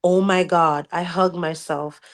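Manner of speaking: ordinary talk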